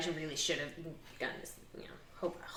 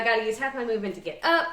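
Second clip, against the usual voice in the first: usual speaking voice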